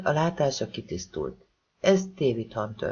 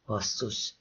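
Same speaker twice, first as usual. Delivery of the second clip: a soft, hissed swear under the breath; hissed curse; 5 semitones lower, 9 dB quieter than usual. Acted. Mia hisses a curse as the danger dawns on her, soft and sharp. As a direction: spit it out low and quiet, through the teeth. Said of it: "sziszegte Mia".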